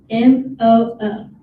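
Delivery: slow, loud letter by letter; spelling it out. Real, not acted. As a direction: spell each letter out clearly. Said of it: "the winner has to spell".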